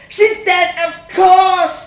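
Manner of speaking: very loud, high scream. A narrator's reading. The boy screaming across the table, very loud and high. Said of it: "I screamed".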